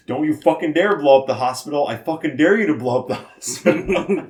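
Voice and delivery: loud, hammed-up tough guy; mock furious threat. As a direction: bark the dare like an action hero. Horse threatens bomber.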